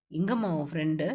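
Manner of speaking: low male character voice; young man's voice. A narrator's reading. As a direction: drop low for the hero asking. Narrator voicing the male lead.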